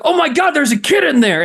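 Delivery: high-pitched retold panic; mock alarm. Onlooker spots a car seat in a smoking car, mock alarm. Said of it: "he sees all the smoke".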